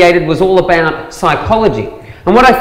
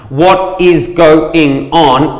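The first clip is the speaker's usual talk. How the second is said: very slow, every syllable separate; drawn-out full syllables; much slower than usual. Shown as a demonstration. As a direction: spell out WHAT IS GO-ING ON syllable by syllable. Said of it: "five syllables".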